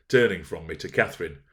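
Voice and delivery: plain reading voice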